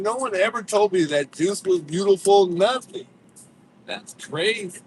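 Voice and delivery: hammed-up jealousy; mock offended. Mock offense at chat praise.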